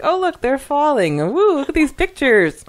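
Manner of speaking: high, loud, gleeful onlooker cheering the fall; loud, high mock-cheerful bystander voice; mock carefree Mike. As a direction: play the clueless photographer thrilled by the danger. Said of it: "It's like".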